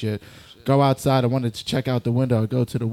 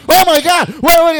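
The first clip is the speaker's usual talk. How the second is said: high, loud mock-panic shriek; hammed-up scream of alarm; freaking out; 11 dB louder than usual. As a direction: imitate a roommate shrieking in panic. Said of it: "You can't freak out right now".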